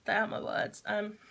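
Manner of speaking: ordinary talk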